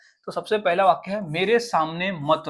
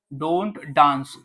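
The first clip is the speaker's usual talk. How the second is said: ordinary talk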